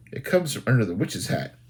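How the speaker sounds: plain reading voice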